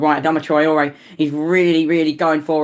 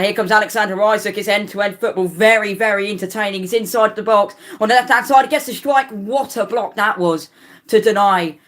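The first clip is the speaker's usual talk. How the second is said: excited play-by-play; 6 semitones higher than usual. Real, not acted. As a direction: build up to the strike and the block. Live play-by-play of an attack.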